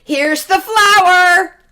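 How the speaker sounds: high excited shout, slow. A narrator's reading. Jack calling his mother with the flour, shouting. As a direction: call it out loud and high like an eager boy. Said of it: "shouted Jack".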